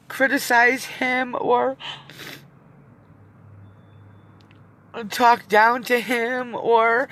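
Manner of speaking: words dragged out through welling tears; tearful. Real, not acted. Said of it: "getting, like, emotional like that".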